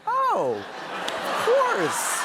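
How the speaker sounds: high, very slow mock understanding; sarcastic reply to the doctor; sarcastic realization. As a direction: drag it out in sarcastic disbelief. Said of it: "I was like".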